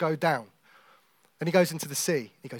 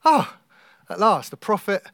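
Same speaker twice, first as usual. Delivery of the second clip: acted relief; 5 semitones higher, 8 dB louder than usual. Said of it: "where you're like".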